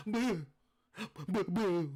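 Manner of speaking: loud, high ghostly boos; mock ghost boo. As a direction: spook them with loud ghost calls.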